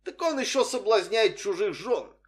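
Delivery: high-pitched scandalised reproach; hammed-up moral indignation; silent public disapproval. A narrator's reading. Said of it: "читал я в их взглядах".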